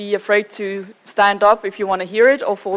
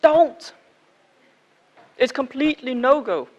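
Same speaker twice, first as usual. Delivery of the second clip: firm, raised "Don't!", then emphatic no-go; retold refusal; 4 semitones higher than usual. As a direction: quote a mock-stern refusal. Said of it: "and I was told".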